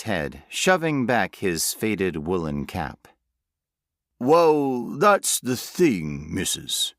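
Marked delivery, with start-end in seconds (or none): 4.2-6.9 slow, ponderous rural drawl; broad country workman, thinking; country accent. A narrator's reading. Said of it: "his country accent as thick as homemade jam".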